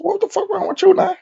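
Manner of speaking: very high, incredulous squeak; retold bafflement. Retold startled question to cellmate.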